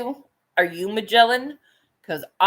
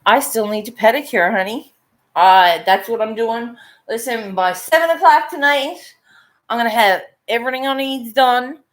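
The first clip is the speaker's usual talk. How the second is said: fired up; 8 dB louder than usual. Real, not acted. Telling viewers her plan, loud and fired up. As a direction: pump yourself up about the day.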